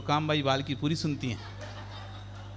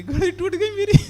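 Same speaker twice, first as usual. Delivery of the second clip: much higher mock whine through laughter; mock lament laughing; 14 semitones higher than usual. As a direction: wail about the watch while cracking up. Servant mourns watch, preacher laughs. (हँसते हुए नकली रोना, बहुत ऊँचे स्वर में)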